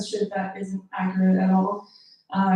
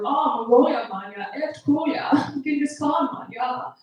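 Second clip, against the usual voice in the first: mock German guy's enthusiasm; German accent; 4 semitones higher than usual. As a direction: imitate a German fascinated by it all. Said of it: "talking to this German guy".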